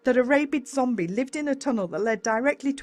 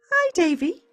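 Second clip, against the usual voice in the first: higher, bright motherly greeting; Mum's voice; 11 semitones higher, 5 dB louder than usual. A narrator's reading. Mum greeting her son at breakfast, higher. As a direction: greet him like a cheerful mum, pitched up.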